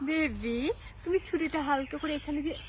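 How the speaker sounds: low, hushed mock endearment; soft coaxing. Wife sweetly answering husband's death wish, soft coaxing. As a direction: coax softly and low, like a lover. Coaxing spouse to use a knife.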